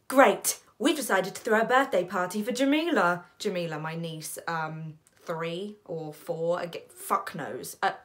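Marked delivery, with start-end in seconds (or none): 0.1-3.2 loud, quick, upbeat announcement; enthusiastic brother. Acted. Brother Flynn announcing a birthday party, loudly enthusiastic. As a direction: barrel on with the happy news.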